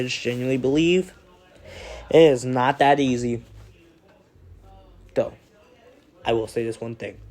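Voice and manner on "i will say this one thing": ordinary delivery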